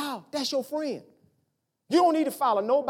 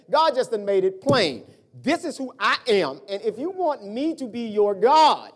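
louder, driving sermon delivery; preaching at full force; 5 semitones higher, 7 dB louder than usual. Acted. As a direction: build it like a preacher pressing the point. Preaching to the congregation.

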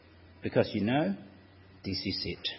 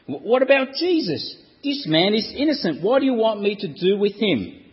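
high, confused pleading question; baffled Pilate; 6 semitones higher than usual. Acted. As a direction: sound at a loss before the crowd. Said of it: "He's baffled".

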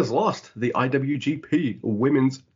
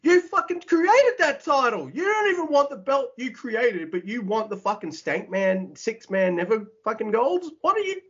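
high, indignant mock rant, much higher; retold outrage; 12 semitones higher than usual. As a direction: squeal it out in disbelief like a wrestling fan betrayed. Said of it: "And I'm like".

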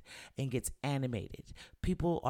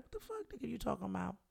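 much higher, softer mock outrage; hurt friend pitching up high in mock protest; retold indignant squeal; 8 semitones higher, 7 dB quieter, faster than usual. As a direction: squeak out your disbelief at the feedback. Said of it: "you'll be like".

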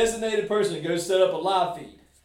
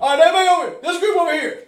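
mock call-out; 7 semitones higher, 7 dB louder, faster than usual. Friend calling the group.

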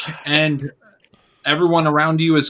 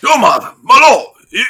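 high, loud shouting guard; guard suddenly alarmed by the stranger's wounds, shouting high; 10 semitones higher, 8 dB louder than usual. Acted. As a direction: yell it out like a panicked underling. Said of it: "So the guard's like".

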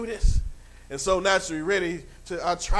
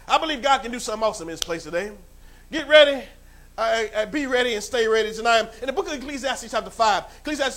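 rising preacher's call, loud; preaching at full force; 2 semitones higher, 7 dB louder, faster than usual. Acted. Pastor rallying the congregation, loud preaching. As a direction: fire up the congregation.

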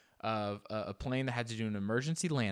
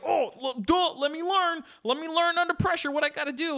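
high panicky passenger; 5 dB louder than usual. Pretend.

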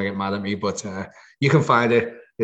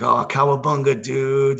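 higher, louder mock American dude; imitating friends ribbing him, raised voice; mocking friends' impression; 5 semitones higher, 4 dB louder than usual. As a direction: tease with a put-on cowabunga cry. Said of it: "They're usually like".

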